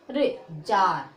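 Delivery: teacher breaks it down: रे, then a slow जार; slow model word. Shown as a demonstration. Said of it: "क्या पढ़ोगे". (रे, फिर जार को धीरे और नीचे सुर में)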